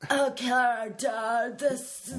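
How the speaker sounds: slow, laid-back hippie. Retold or imitated. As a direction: stretch it out like a stoner. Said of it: "In the hippie voice".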